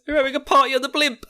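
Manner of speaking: high, excited put-on voice; mock party excitement. Crew partying on the ship, high mock excitement. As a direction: announce it in a giddy high voice.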